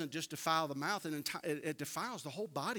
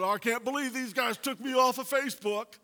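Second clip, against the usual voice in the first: indignant complaint; 8 semitones higher, 11 dB louder than usual. Retold or imitated. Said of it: "everybody that he knew was going".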